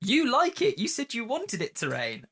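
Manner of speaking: high mocking teasing voice; teasing coworker. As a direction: tease them with a high voice. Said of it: "And someone went".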